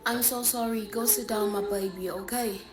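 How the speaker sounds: mild tender reassurance; soft, sympathetic teacher. Acted. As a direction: soothe the child gently. Teacher comforts pupil.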